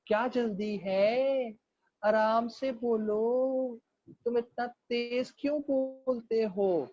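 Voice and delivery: imitating an unhurried local, every word stretched out; slow Punjab-side speaker. Punjab local telling a fast-talking Karachi newcomer to relax, exaggeratedly slow. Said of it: "कराचीइट्स की बोलने की speed तेज है".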